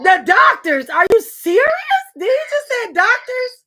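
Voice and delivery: high, loud shout of disbelief; can't believe he said doctors; incredulous shout. Real, not acted. Host shouting in disbelief at the guest's doctors answer.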